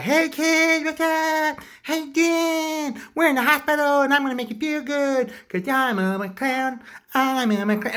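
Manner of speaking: high, loud kiddie-clown voice; performing clown. Acted. Clown cheering up hospital kids, high showy clown voice. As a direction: squeal it out like a children's entertainer.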